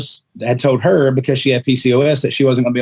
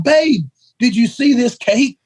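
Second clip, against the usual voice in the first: excited retelling; 8 semitones higher than usual. Retold or imitated. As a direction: replay the moment you saw the cake, pitched way up. Retelling his excited reaction to wife.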